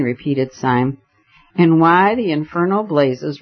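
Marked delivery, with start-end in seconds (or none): none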